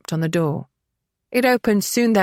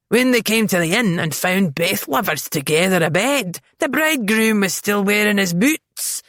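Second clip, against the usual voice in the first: put-on thick Scottish accent. A narrator's reading. Innkeeper to eloping couple, thick Scottish accent. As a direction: roll it out in thick Scots.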